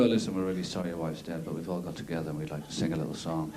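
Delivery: low, soft sombre voice; mock condolence. Mourners to widower, low soft mock sympathy. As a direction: murmur like at a funeral.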